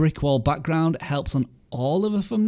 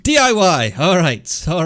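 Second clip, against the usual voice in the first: announcing; 4 semitones higher, 7 dB louder, slower than usual. Real, not acted. Announcing the next channel.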